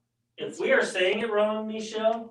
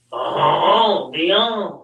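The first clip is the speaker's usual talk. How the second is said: loud, hammed-up French accent; put-on French voice; 7 dB louder than usual. Husband teases wife with her name, hammed-up French accent. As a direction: croon it like a movie Frenchman, louder. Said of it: "say it in my French voice".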